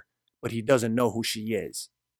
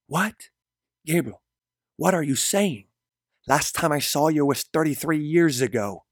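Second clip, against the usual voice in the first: higher, startled woman's reply; higher, shocked disbelief; surprised woman voice; 4 semitones higher, 4 dB louder than usual. Acted. Mari surprised.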